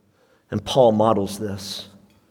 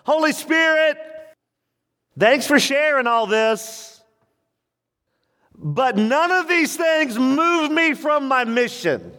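Paul's voice; 13 semitones higher than usual. Retold or imitated. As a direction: say it firmly, unmoved. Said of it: "One translation says it like this".

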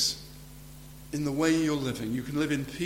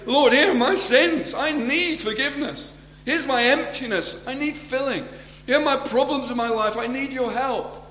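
high, raised voice crying out to God; earnest plea; 10 semitones higher, 5 dB louder than usual. Acted. Sinner comes to Jesus and asks for help, earnest plea. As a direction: plead loudly, pitched well above normal talk. Acted prayer of a repentant sinner.

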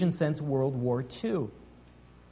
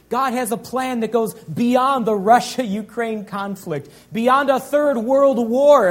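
rising preacher's shout; 6 semitones higher, 6 dB louder than usual. Real, not acted. Preacher telling his church God's plan outlasts war, rising to a shout. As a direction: build it up like a revival preacher hitting the peak.

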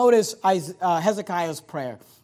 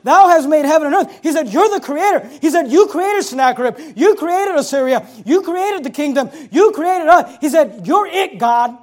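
raised, loud pulpit declaration; forceful preaching; 7 semitones higher, 10 dB louder than usual. Acted. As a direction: hammer each phrase home to the congregation. Preaches Hezekiah's praise with force.

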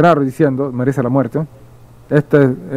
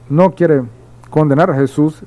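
usual speaking voice

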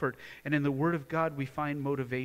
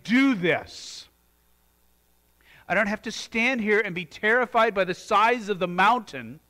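a soaring pulpit exhortation; full-force preaching; 7 semitones higher, 10 dB louder than usual. Acted. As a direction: preach it with mounting fire.

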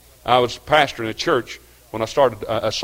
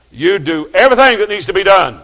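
loud, higher, hammering each word; preacher shouting the boss's words; booming repeated order; 9 semitones higher, 12 dB louder than usual. Retold or imitated. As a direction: thunder the boss's command at the congregation.

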